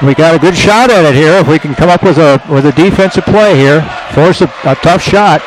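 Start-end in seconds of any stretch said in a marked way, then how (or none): none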